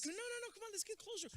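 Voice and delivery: high mock pleading; eager urging. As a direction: pull her along, all excitement. Urging his girlfriend on the boat.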